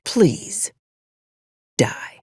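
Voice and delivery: breathy, unvoiced hush of a threat; menacing whisper. Commander threatening the freelancer, a menacing whisper. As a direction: whisper it like a death sentence.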